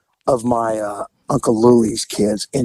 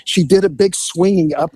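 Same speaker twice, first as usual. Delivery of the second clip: ordinary talk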